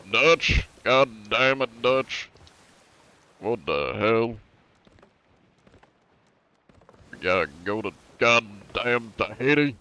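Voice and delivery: hammed-up Southern cowboy, exasperated; Southern outlaw impression. Outlaw complaining to Dutch about Tahiti, mock Southern drawl. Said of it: "I can't really do a Dutch".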